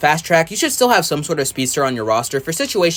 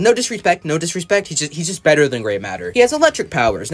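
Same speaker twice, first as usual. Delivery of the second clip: ordinary talk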